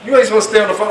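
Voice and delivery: ordinary talk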